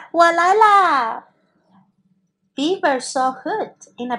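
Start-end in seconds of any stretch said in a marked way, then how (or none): 0.1-1.3 loud, slow shout in Chinese; 大声拖长地喊; calling out. A narrator's reading. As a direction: call out to the hiders, stretched. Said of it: "他也没有听到河狸在喊".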